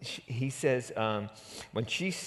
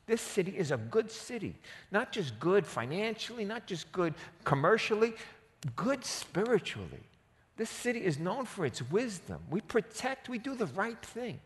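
higher, animated voice of the wise woman; woman protesting the city's destruction, higher and animated; pleading woman; 8 semitones higher than usual. Retold or imitated. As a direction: plead with him, indignant and amazed. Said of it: "She's like".

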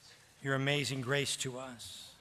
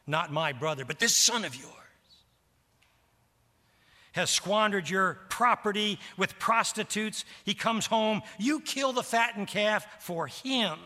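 bitter cursing; 7 semitones higher, 5 dB louder than usual. Acted. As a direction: rail at the father, spitting out this son of yours. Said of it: "as a cuss word, a curse".

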